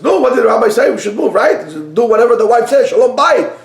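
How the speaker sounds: loud, high insistent wife; nagging wife. Acted. Wife loudly pushing husband about the rabbi's advice, high and insistent. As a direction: press him hard to obey the rabbi. Wife demands they move.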